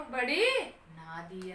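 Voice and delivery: slow, drawn-out protest, falling away; insistent child. Acted. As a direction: stretch the words out as a stubborn boy. Boy repeats his drawn-out claim.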